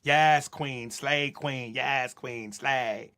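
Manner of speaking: low mock cheer, lightly voiced; mock the empty cheerleading; mocking hype cheer. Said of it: "hypocrisy and the contradiction".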